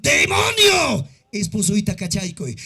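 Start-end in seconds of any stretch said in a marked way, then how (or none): 0.0-1.1 preaching shout. Real, not acted. Preacher rebuking the demon.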